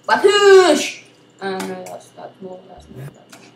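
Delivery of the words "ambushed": Wabushed! said high and loud; loud silly cry. Pretend. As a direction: shout the silly word. Louder repeat.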